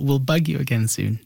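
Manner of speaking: ordinary delivery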